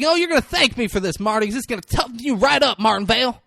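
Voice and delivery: high, loud taunting call; mock screaming. Imitating the client screaming after Marty in the courtroom. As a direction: holler it across a full room. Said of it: "screaming this".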